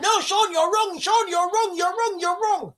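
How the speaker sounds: high, whiny chorus of you're wrong; mock whiny viewers. Host mimics viewers telling him he's wrong, high and whiny. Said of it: "everybody's like".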